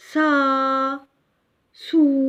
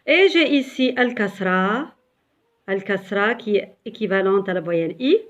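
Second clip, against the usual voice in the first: normal talking voice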